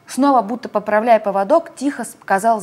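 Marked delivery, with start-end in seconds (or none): none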